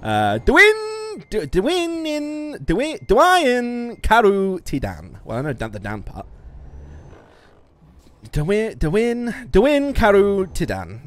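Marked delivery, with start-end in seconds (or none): 0.0-5.2 "Dwin Karu Tidan" sounded out slowly, higher and louder; attempting Welsh. Shown as a demonstration. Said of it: "I'm gonna try and fucking pronounce it". 8.3-11.0 "Dwin Karu Tidan" said slowly, higher; careful slow "Dwin Karu Tidan"; final Welsh attempt. Shown as a demonstration. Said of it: "That's my final answer".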